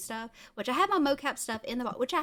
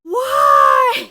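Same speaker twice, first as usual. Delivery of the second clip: loud high disbelieving cry; a shocked, outraged shout; incredulous shout; 19 dB louder than usual. Retold or imitated. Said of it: "he's like".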